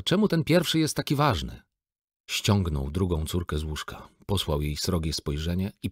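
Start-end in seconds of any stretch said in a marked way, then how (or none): 0.0-1.6 put-on much higher, childlike question; curious little girl. A narrator's reading. Little daughter asks father why first day matters, childlike. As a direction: ask brightly like a small girl. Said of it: "little girl asks father".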